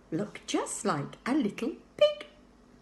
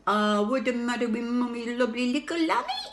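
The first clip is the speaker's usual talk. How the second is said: cooing mother; 4 semitones higher, 3 dB louder than usual. A narrator's reading. Mother fussing over her crying baby, cooing. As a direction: coo at the baby.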